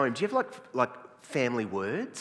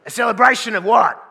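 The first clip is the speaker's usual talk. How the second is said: loud, high, incredulous question; older guy; 10 semitones higher, 16 dB louder than usual. Acted. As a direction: fire it back in exasperated disbelief. Older guy's exasperated question.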